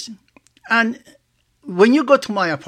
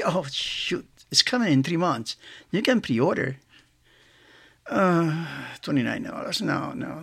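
talking to himself; 5 semitones lower, 8 dB quieter than usual. Retold or imitated. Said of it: "that's the way I talk".

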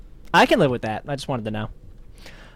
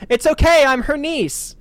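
shouting out; 9 semitones higher, slower than usual. Retold or imitated. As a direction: shout it out cheerfully. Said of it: "Bob shouts out".

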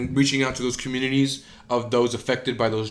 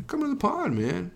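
impression; 8 semitones higher, 5 dB quieter than usual. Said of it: "that's more Eben Britton".

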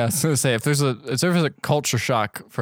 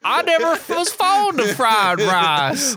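mock whiny character voice; 10 semitones higher, slower than usual. Continuing the high character voice.

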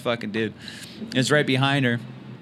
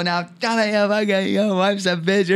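high, slow re-enacted taunt; hammed-up heckle from the mic; mock taunting; 8 semitones higher, slower than usual. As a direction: jeer it out high and drawn out. Re-enacts taunting floored man on mic.

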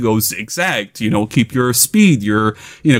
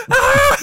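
yelling scream. Retold or imitated. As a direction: scream flying down a hill out of control. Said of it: "just yelling".

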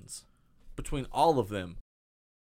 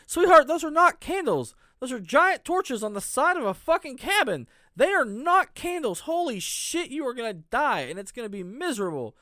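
much higher, louder exasperated yelling; exasperated rant; 9 semitones higher, 4 dB louder than usual. Real, not acted. Host yells at the clueless character on screen, exasperated. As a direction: shout at the screen in disbelief.

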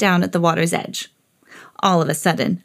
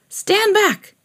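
high commanding call; warning crowd; 12 semitones higher than usual. A narrator's reading. Attendant warning the crowd, commanding. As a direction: order the crowd back. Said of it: "one of them said to the crowd".

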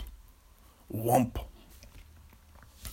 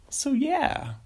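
mock newscaster sign-off, much higher; news anchor sign-off. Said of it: "What if they did that on the news?".

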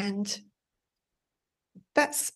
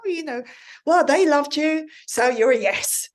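mock gushing judge; 8 semitones higher, 7 dB louder than usual. [name] imitating a fellow judge going along with the audience, mock gushing. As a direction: ham up a judge swayed by the crowd's love. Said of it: "if they go".

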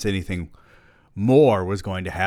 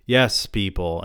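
ordinary delivery

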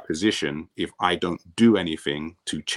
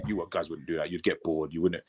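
softly spoken deadpan dismissal; 7 dB quieter than usual. Retold or imitated. Coach telling players they'd get bored, deadpan. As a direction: imitate a coach, quiet and unmoved. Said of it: "he's so deadpan".

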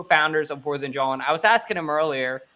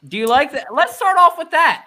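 excited; 11 semitones higher, 8 dB louder than usual. Real, not acted. Host hypes the topic.

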